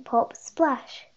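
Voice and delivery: ordinary delivery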